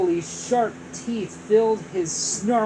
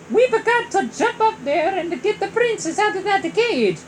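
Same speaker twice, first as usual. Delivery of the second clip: high, louder hero voice; Mario voice; 12 semitones higher, 6 dB louder than usual. Acted. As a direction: say it as an eager plumber. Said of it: "Mario said".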